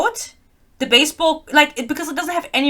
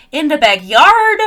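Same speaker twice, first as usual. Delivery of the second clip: ordinary talk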